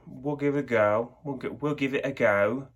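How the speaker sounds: put-on British accent with rising pitch; English accent. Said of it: "I can totes do that English accent".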